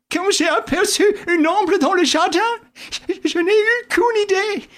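an anxious sidekick explaining; Wilson's voice. A narrator's reading. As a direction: play the flustered Wilson.

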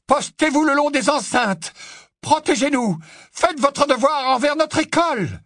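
high, ringing battle orders; rally the troops at top pitch; yelling. A narrator's reading. Professor commanding the statues to defend the school, yelling. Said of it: "hurla le professeur McGonagall".

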